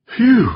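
high, loud whoosh of relief; gusted phew. A narrator's reading. Woman strapped into shuttle seat, high gusty phew. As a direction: gust out a high-pitched phew. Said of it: "V J gusted".

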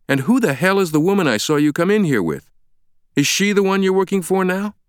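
high, accusing questions; angry demand. A narrator's reading. Stranger accuses Langdon over the woman, demanding. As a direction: interrogate him with indignation. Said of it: "the man demanded".

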